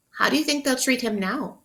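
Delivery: usual speaking voice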